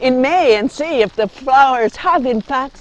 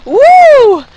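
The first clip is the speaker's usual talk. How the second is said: high loud whoop of joy; loud high cheer; excited whoop; 12 semitones higher, 9 dB louder than usual. Real, not acted. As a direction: let out a big happy woo. Joyful whoop in the rain.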